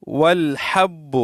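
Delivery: والحب slowly, with ح squeezed from the throat; correct throaty ha. Shown as a demonstration. Teacher repeating the right reading, slow throaty ha. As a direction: draw out والحب, throaty h and doubled b. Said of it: "اس طرح پڑھیں گے".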